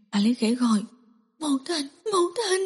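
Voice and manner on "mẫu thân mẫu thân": high, soft whimpering cry for mother; pained moaning. Acted. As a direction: moan it out in pain, half crying. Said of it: "đau khổ rên rỉ".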